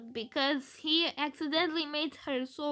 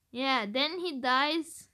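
ordinary talk

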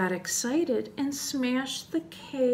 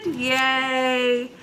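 long, drawn-out yay; happy storyteller's cheer. Acted. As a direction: give a sustained little cheer.

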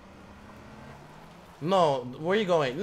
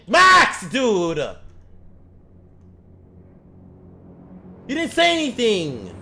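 angry yell; 7 dB louder, slower than usual. Real, not acted. Yelling at teammate Max.

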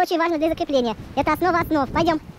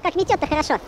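normal talking voice

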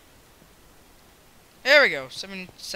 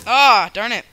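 loud, higher cry of defeat; frustrated yell; 6 semitones higher, 18 dB louder than usual. Real, not acted. Gamer just beaten, frustrated yell. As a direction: cry out at losing. Loses in the game.

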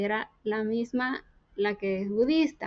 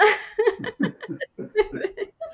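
laugh; 9 semitones higher than usual. Real, not acted. Laughing at her own story.

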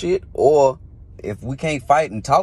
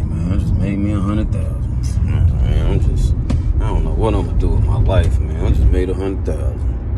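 low, gloomy mock bragging; mock depressed; 8 semitones lower than usual. Shown as a demonstration. Rapper showing fans how to program sadness, low gloomy mock moan. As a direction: moan about your riches like it hurts. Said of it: "That's some old sad, depressed ass shit".

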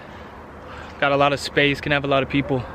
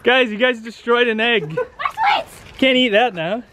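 shouting; 7 semitones higher than usual. Real, not acted. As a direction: shout about the broken egg. Egg smashed, shouting.

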